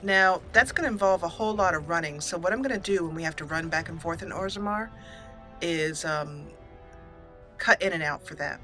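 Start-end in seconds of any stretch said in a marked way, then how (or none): none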